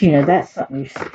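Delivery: usual speaking voice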